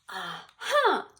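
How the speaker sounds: loud, high eureka cry; triumphant Aha. A narrator's reading. Cookie finally has the idea, a loud delighted Aha. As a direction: burst out with the discovery.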